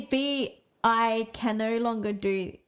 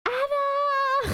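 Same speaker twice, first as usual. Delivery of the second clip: long, much higher squeal of the name; drunken delighted cry. Retold or imitated. Drunk sister on the phone hearing her friend, delighted squeal. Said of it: "And I was like".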